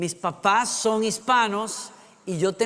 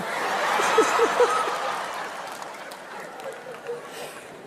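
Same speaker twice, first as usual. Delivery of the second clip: helpless high laugh; high laughter. Real, not acted. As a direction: keep laughing. Laughs after apology. (risa aguda)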